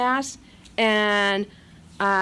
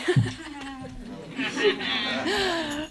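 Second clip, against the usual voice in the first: a real laugh, softer and trailing; 3 semitones higher, 4 dB quieter than usual. Real, not acted. Laughing after recalling her friend's invitation. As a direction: laugh at the memory.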